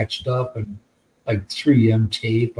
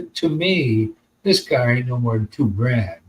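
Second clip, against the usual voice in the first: normal talking voice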